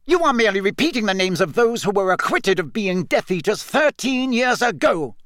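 high, quick angry rebuttal; irate, insistent retort. A narrator's reading. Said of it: "said Fudge angrily".

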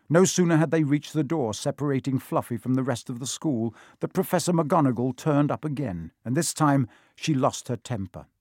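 ordinary delivery, nothing marked

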